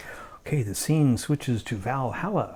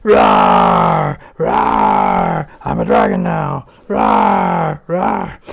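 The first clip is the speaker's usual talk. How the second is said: loud roaring dragon; 10 semitones lower, 13 dB louder, much slower than usual. Acted. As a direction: roar like a monster, full force. Alberic turned into dragon.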